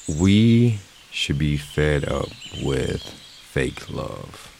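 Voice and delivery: each word weighed, very slow; slow, deliberate emphasis; slow emphatic statement. Real, not acted. Opening statement of the topic.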